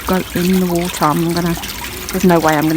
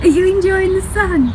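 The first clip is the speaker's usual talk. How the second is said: much higher, squeaky doggy-voice question; 11 semitones higher, 6 dB louder than usual. Real, not acted. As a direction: coo it even higher at the pet. Repeats question to the dog.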